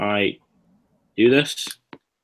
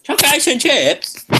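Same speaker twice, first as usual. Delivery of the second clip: put-on higher, slow childish voice; little kid voice again; 3 dB louder, slower than usual. Repeating the kid's TV line, higher and slow. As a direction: drawl it like a small child.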